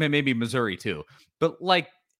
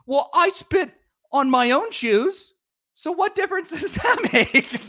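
much higher, loud mocking voice, laughing at the end; mock Auburn fan; 5 dB louder, slower than usual. Host mimics Auburn fan excusing his spitting, high mock. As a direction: squeak it out like a clueless rival fan. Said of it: "and then go like".

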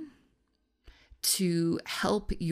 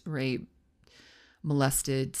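ordinary delivery

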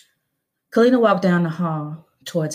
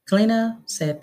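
ordinary delivery, nothing marked

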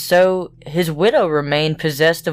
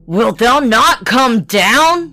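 shouting; 5 semitones higher, 4 dB louder, slower than usual. A narrator's reading. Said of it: "shouted Bluebeard".

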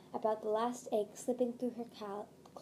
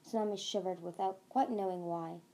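ordinary delivery

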